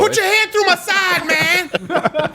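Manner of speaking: high, far-off Jesus voice. Acted. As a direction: do a hammy Jesus. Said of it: "it's Jesus Christ".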